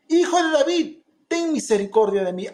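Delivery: high, loud pleading cry. Retold or imitated. Blind beggar calling to Jesus, loud pleading cry. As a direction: cry out to be heard. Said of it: "él clamaba mucho más".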